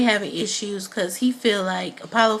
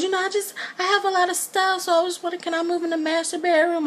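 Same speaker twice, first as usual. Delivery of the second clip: put-on high whiny girlish voice, much higher; mimicking Portia; 10 semitones higher, faster than usual. Vlogger mimics Portia asking her mother for the master bedroom, in a high whiny voice. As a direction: put on a high, spoiled little-girl voice. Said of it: "she just goes".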